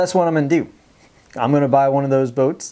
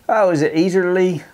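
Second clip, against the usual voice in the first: mock Texas accent, pitched higher; 5 semitones higher than usual. Vlogger jokes about how Texans talk, in a Texas accent. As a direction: put on a playful Texas accent for the question. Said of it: "know how we do it in Texas right there".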